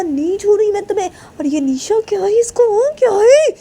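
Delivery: very high-pitched angry rant; worked-up girl scolding shrilly; furious, jealous friend. A narrator's reading. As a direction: rail indignantly without letting up. Voices furious Sneha.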